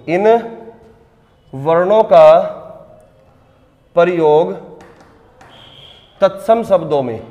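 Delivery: words spaced out with long pauses for writing. Acted. Teacher dictates notes to students.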